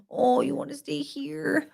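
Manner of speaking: soft, high coo; cooing pet talk. Real, not acted. Owner asking the dog if it wants to stay, softly cooing. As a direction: ask gently in a small high voice.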